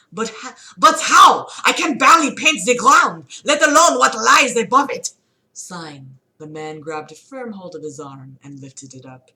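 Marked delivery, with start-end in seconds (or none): 0.1-5.1 high, loud, whiny panic; panicked protest. Acted. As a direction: wail it like a helpless student.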